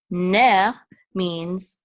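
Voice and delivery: ordinary delivery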